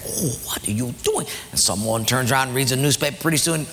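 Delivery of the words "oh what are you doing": worried friend. Acted.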